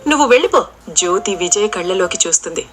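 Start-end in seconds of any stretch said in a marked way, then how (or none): 0.1-0.6 angry. A narrator's reading. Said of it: "కోపంగా చూస్తుంది".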